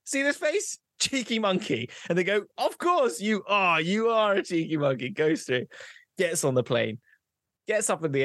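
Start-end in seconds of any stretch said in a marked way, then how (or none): 0.1-1.9 high-pitched cocky bluff; brazen cheeky monkey. Acted. Cheeky monkey at passport control without a passport, high cocky voice. 2.6-5.1 indulgent official. Acted. Official gives in, catchphrase.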